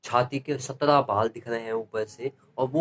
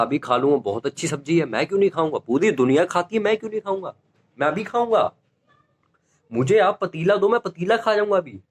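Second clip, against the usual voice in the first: rising higher and higher, frantic eagerness; panicked eager reply; 5 semitones higher than usual. Retold or imitated. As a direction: overeager kid backpedalling in panic. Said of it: "मैं कहा". (डर के मारे बढ़ता हुआ जोशीला जवाब)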